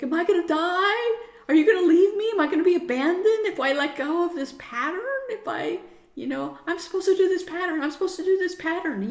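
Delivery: put-on high, loud, frightened child's voice; scared little kid. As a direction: voice a panicking little child begging not to be left. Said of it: "the little kid's like".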